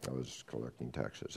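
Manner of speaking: low, soft, ashamed confession. Acted. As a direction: drop your voice in shame. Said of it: "lowers his voice".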